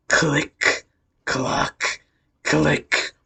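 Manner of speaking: slow, evenly spaced click-clock; storyteller voicing mechanical clicks and clocks; imitated clicking sound. A narrator's reading. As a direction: tick out each word with gaps between. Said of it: "You just hear".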